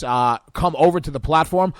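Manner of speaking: ordinary talk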